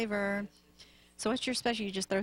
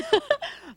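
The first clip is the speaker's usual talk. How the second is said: embarrassed laugh; 9 dB louder than usual. Real, not acted. Caught eating on air.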